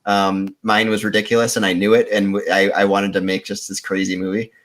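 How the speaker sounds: usual speaking voice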